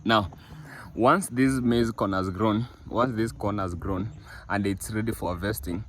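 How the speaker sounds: ordinary talk, nothing marked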